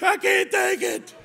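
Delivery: high, loud, slow wail with a laugh; boy clinging to the principal, wailing through a laugh; mock wailing plea. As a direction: drag it out like a hammed-up sob. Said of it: "started screaming".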